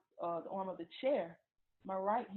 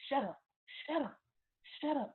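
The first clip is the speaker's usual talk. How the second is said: short, higher-pitched repeated order; retold angry command; 6 semitones higher than usual. Boyfriend silencing her in the shower, retold angry command. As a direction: imitate someone angrily silencing a person, not shouted.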